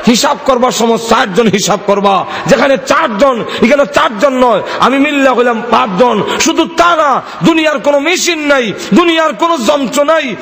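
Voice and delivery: high, driving sermon chant; preaching. Acted. Preacher telling congregation Allah knows their hidden thoughts, high impassioned preaching.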